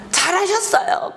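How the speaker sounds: mock-congratulate, dripping with irony; sarcastic praise. Jonah sneering at God's mercy, sarcastic high praise.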